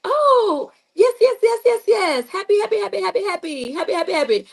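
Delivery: mock forced-cheery "happy, happy, happy"; fake cheerful. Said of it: "my other people like".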